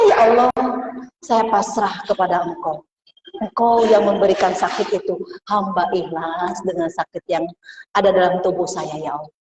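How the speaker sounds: hushed prayer of surrender. Acted. Voicing a patient's prayer to God.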